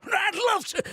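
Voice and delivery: high, loud burst of delight; eager outburst. Acted. Bike fan offered a look at a ride, eager outburst. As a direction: jump at it, pitch shooting up.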